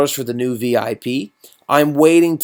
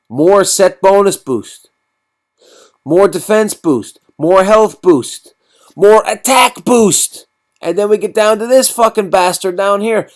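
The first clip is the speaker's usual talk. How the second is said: excited; 6 semitones higher, 4 dB louder than usual. Real, not acted. Listing boosts, building hype.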